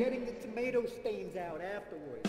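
Mister Tomato voice. Acted. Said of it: "snaps for Mister Tomato".

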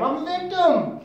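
hammed-up victim's complaint; playing the victim. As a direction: cry out the blame in exaggerated protest. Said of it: "she's basically playing the victim game".

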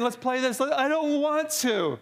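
put-on high sulky child's whine; mock childish whine; whiny child. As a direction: pout it like a spoiled kid. Children refusing every game.

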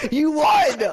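loud, high exclamation; amazed shout. Real, not acted. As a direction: burst out in amazement at the win. Amazed friend won the round.

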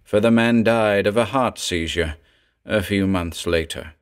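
ordinary delivery, nothing marked

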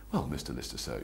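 lightly voiced butler, low and soft; understated, deferential English butler; a hint of a quiet butler. Shown as a demonstration. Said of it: "English butler".

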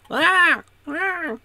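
very high, loud mock scream; two short imitated shrieks. Imitating Reva screaming every episode, mock screams. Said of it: "Reva is screaming".

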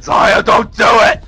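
high, loud acted shout; urgent inner warning. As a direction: shout it like your brain stopping you. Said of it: "my brain kicked in".

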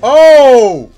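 very loud, high cry of shock; shocked yell. Real, not acted. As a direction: explode at the shocking moment. Reacting to shock on screen.

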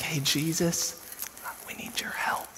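soft, tentative whisper; timid polite wake-up. Acted. Disciples gently waking Jesus, timid whisper. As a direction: tiptoe round a sleeper, hushed and apologetic. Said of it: "It's not like".

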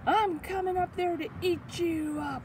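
high-pitched troll threat; storybook troll on the attack; threatening troll. Acted. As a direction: call it out as a hungry troll. Troll threatens middle goat.

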